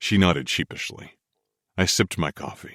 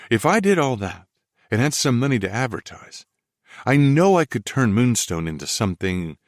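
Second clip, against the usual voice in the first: lightly raised woman's voice; young woman voice; 6 semitones higher than usual. Acted. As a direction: voice her higher, eager. Felicity voiced by narrator.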